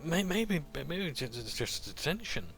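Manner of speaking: high, nervous stutter; stammering. Acted. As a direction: stutter out a timid answer. Boy stammers reply.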